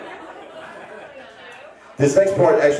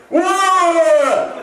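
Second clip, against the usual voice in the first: gleeful shout; 11 semitones higher than usual. Shown as a demonstration. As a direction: belt out Wawa like a cheer. Shouting the store name gleefully.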